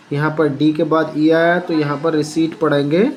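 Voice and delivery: ordinary talk, nothing marked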